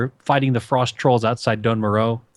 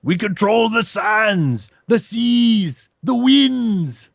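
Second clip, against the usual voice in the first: slow, higher, swelling proclamation; grand boss voice; 8 semitones higher, 3 dB louder, much slower than usual. Retold or imitated. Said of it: "he was like".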